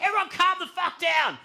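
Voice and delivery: high-pitched yell over a panicking group; frantic command. Retold or imitated. Shouting over friends freaking out.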